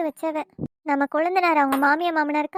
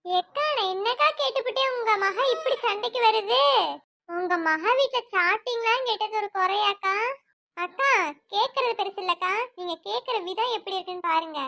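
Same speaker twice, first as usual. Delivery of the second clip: higher, complaining village woman's voice; quarrelsome village woman; 3 semitones higher than usual. Acted. Neighbour woman protesting to her elder sister, higher and complaining. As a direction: whine and pick a fight with the older sister.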